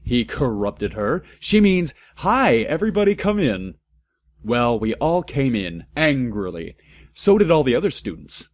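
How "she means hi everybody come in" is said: high, loud blaring correction. A narrator's reading. Headmaster correcting the teacher, loud blaring. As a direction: blare it over her. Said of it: "Dumbledore blared at her".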